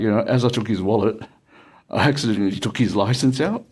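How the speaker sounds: ordinary delivery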